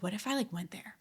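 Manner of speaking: softly voiced musing to oneself; tentative inner thought. Recalling a secret idea to a friend, quiet and tentative. Voicing her own intrusive thought.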